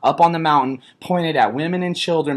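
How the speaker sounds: loud, high, furious; angry shouting. Real, not acted. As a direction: yell it out in outrage. Heated rant about snipers.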